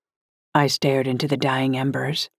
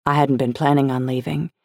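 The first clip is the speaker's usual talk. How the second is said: plain reading voice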